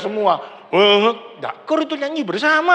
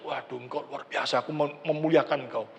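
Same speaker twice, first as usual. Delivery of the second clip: awed quiet worship; 8 semitones lower, 8 dB quieter than usual. Shown as a demonstration. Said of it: "Merasakan bahwa kekudusan Allah itu sebegitu dahsyat".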